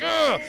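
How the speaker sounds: high, loud straining grunt; mock strain grunt. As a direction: grunt as if pushing hard. Imitating strain of locked lightsabers.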